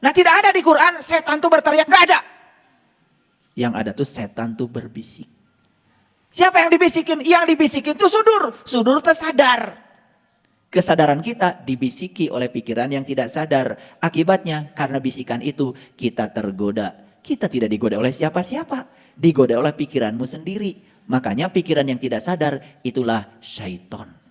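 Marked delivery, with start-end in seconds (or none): 3.5-5.3 very low, soft murmur; hushed. Shown as a demonstration. Menirukan bisikan setan saat menjelaskannya. 10.7-24.2 low, soft confiding voice; hushed. Shown as a demonstration. Menjelaskan bisikan pikiran dengan suara lirih.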